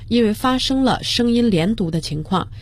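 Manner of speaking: normal talking voice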